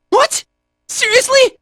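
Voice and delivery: high-pitched disbelief; incredulous. Acted. Fighter can't believe it, high incredulous voice. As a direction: blurt it out in disbelief.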